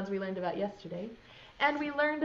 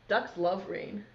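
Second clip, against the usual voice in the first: ordinary talk, nothing marked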